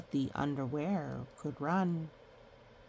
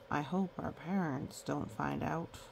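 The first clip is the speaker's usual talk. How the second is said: plain reading voice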